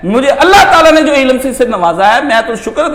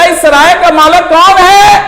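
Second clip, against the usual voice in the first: higher, louder, slow holy man's cry; beggar calling out; 8 semitones higher, 5 dB louder than usual. Retold or imitated. Dervish calls loudly outside the king's palace. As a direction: cry it out slowly, pitched high. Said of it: "محل کے باہر آ کے آواز دی".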